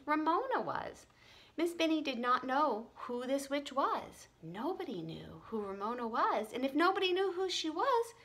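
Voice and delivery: plain reading voice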